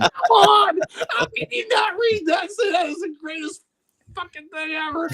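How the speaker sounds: very high, squealing enthusiasm; real delighted outburst. Real, not acted. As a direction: gush in a high-pitched, laughing squeal. Bursts out delighted over the line.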